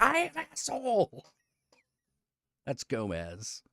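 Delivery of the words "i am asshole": very high, chirpy cat voice; smug cat voice. Retold or imitated. Streamer voices the comic's cat answering its owner, squeaky high. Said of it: "Cat is like".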